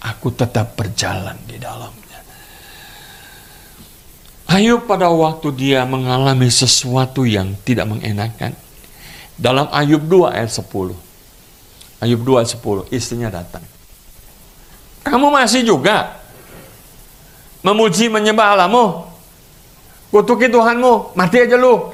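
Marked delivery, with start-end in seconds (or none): 0.1-2.2 whispered surrender. Acted. 15.0-21.9 mock the wife sneering at Job; scornful wife. Wife tells Job to curse God, shrill high mockery. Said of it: "kata istrinya gitu".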